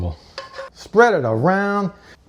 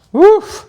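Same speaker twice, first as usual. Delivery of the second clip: excited woof; 6 dB louder than usual. Real, not acted. Reacting to the dish from oven.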